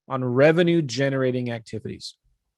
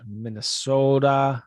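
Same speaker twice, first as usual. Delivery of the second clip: long drawn-out Minnesota with a round O; mock Minnesotan drawl on Minnesota; slow Minnesota accent; slower than usual. Shown as a demonstration. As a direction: stretch Minnesota slowly, leaning on the O. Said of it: "They say it really slow".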